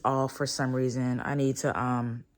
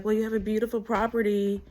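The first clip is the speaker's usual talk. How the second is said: higher, quicker mock-polite officer; a light impression of the cop's small talk; imitating the officer; 7 semitones higher, faster than usual. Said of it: "the officer was like".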